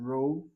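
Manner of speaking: "ro" alone, left open on the vowel; open first syllable. Shown as a demonstration. Teacher breaking the word into syllables, careful pronunciation. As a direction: hold "ro" by itself, no f closing it. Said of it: "first syllable C V open".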